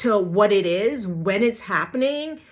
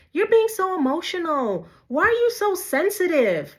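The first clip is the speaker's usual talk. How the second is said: higher, scornful imitation of a gaslighter; mocking dismissive quote; 9 semitones higher than usual. Imitating a partner who dismisses her feelings, scornful and higher. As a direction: sneer it back as the accuser.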